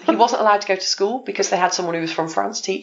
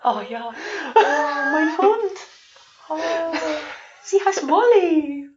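high, half-laughing mock sob in German accent; 11 semitones higher, slower than usual. German kid finds lost dog Molly, mock tearful German accent. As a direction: play a German kid sobbing over his lost dog. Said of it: "I had this German accent".

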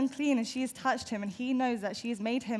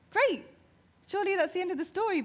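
excited; 8 semitones higher, 3 dB louder, faster than usual. Retold or imitated. Imagined elated reaction.

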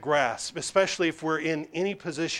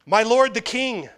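calling out; 5 semitones higher, 6 dB louder than usual. Acted. David calls out to Saul.

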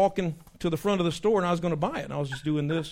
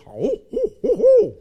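hooting owl, rising higher and louder; 9 dB louder than usual. Shown as a demonstration. Showing which owl hoot turkeys ignore, a rising loud hoot. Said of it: "an owl that goes".

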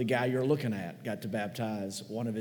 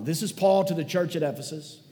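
usual speaking voice

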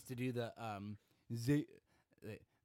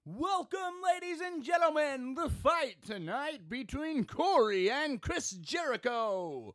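much higher hype ring-announcer call; 12 semitones higher than usual. Acted. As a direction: announce the fight to the arena.

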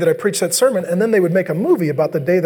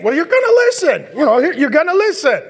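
higher firm parent voice, half laughing; 6 semitones higher than usual. Retold or imitated. Parent telling bratty kid he will listen, firm. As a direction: insist like a fed-up parent.

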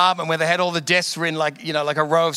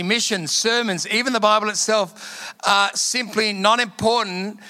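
slow, raised preaching voice, higher pitch; 4 semitones higher, slower than usual. Acted. Preacher quotes Bonnke to the congregation, slow and raised. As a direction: preach the quote slowly from the pulpit.

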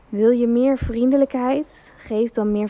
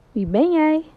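echo reply; 5 semitones higher than usual. Acted. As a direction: answer back like the mountain. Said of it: "het enige antwoord". (de echo roept terug)

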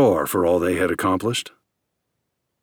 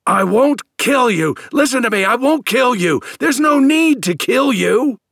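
shouting; 5 dB louder than usual. A narrator's reading. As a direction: shout him down to be heard. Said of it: "The other man began shouting too".

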